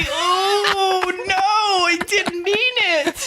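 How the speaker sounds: high, slow, wailing mock apology; hammed-up wailing. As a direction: overdo the tearful plea for forgiveness. Mock apology to offended girlfriend.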